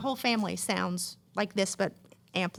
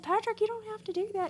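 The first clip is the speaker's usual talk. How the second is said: mock protest; 13 semitones higher, faster than usual. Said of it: "And I'm like".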